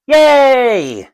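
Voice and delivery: high, drawn-out yay; mock crowd cheer. Solo player voices the cheering stadium, mock cheer. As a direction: play the whole stadium cheering in one long high cry.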